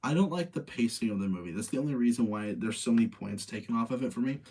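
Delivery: usual speaking voice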